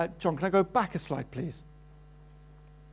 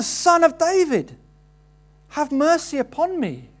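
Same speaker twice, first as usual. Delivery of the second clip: a higher, louder, desperate shout for mercy; even louder cry; 6 semitones higher, 4 dB louder than usual. Acted. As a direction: shout it over the crowd, begging. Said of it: "even louder".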